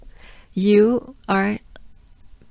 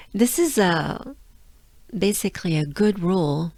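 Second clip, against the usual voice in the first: ordinary talk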